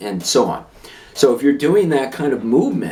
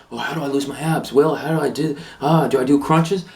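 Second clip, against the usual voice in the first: mock confused asker; 3 semitones higher than usual. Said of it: "if you wanna lose your abs".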